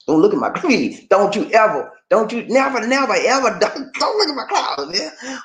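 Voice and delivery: high, hammed-up protest; mock panic pleading. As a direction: plead jokingly, breaking into laughter. Jokingly guards own closet secrets.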